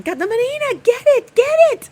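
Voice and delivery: high, loud, excited cheering on; urging the cat. Retold or imitated. Neighbor Monica shrieking at the cat to catch the rat. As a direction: egg the cat on at the top of your voice. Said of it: "Monica's standing here saying".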